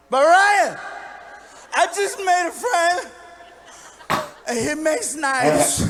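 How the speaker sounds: loud, high drunken whine, hammed-up; slurred, drawn-out drunk protest. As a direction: plead like a plastered overgrown kid. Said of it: "drunk wasn't even the right word".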